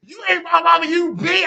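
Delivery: high, mock angry yelling at her mother; retold cussing out mom. Herself as a teen yelling at her mom, mock angry. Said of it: "I'm like".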